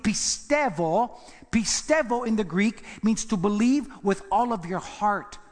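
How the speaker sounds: ordinary delivery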